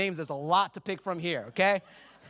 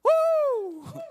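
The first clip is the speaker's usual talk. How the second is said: excited whoop; 4 dB louder than usual. Real, not acted.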